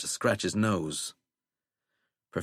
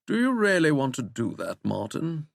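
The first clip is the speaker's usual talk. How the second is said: lightly raised plummy English question; plummy English accent; 5 semitones higher than usual. A narrator's reading. Withering warning the president, plummy English accent. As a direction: ask it coolly in plummy English. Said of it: "in that plummy English accent".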